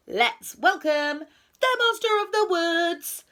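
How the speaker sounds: high, loud announcement; beaming welcome. A narrator's reading. Owl opens the meeting to introduce the monster, beaming. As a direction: proclaim it with a proud smile. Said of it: "Owl beamed".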